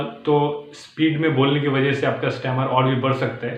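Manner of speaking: normal talking voice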